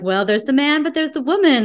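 rising high and sing-song on the woman; playful mock-balanced musing; weighing both sides. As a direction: voice the Libra scales tipping up. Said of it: "I'm like".

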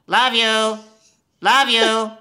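high, loud squawking parrot calling love you. Retold or imitated. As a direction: squawk it like a lonely pet parrot. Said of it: "he would start saying".

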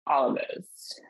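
whispered confession; sheepish whisper. Real, not acted. Admitting to her co-host she wanted every dressing, whispering. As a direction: whisper it like a guilty secret.

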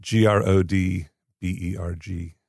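slow letter-by-letter spelling of G R O D B E R G. Shown as a demonstration. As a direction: say G R O D B E R G. one letter at a time. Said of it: "Can you spell it for us?".

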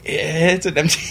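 high-pitched, half-laughing mock protest; retold protest. Retold protest to police questioning.

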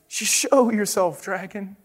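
faltering storybook knight, fading on the last word; squeaky little knight. Acted. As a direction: challenge the dragon in a timid squeak. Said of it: "squeaked a thin, shiny knight".

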